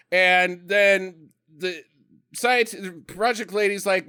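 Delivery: ordinary talk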